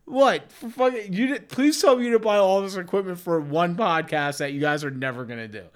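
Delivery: ordinary delivery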